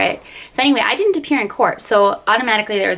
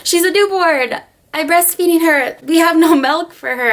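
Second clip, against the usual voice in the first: panicked tearful plea; 9 semitones higher than usual. Retold or imitated. New mother begging the guard not to jail her, high tearful plea. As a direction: beg them to understand, near tears. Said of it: "I'm like".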